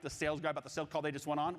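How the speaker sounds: ordinary talk, nothing marked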